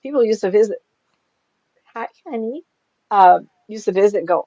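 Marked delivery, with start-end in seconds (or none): 1.9-2.6 high, soft greeting; sweet aside. Real, not acted. Speaker breaks off to greet a pet, sweet aside. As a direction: coo a little hello, high and soft.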